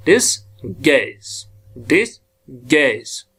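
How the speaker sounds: डिस, गैज said slowly with the weight on गैज; डिस, गैज slow, falling, second beat stressed; stress on second syllable. Shown as a demonstration. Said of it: "second ये accent".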